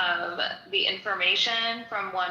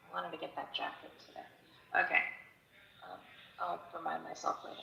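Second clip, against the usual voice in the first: muttering to self; 11 dB quieter, faster than usual. Real, not acted. Muttering to herself aside.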